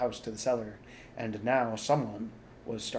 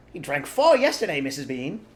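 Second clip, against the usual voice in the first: higher, loud call; shouting up; 5 semitones higher, 11 dB louder than usual. A narrator's reading. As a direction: shout it up the steps. Maid shouts up stairs.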